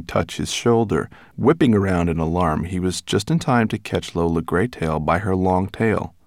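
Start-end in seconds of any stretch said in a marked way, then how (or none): none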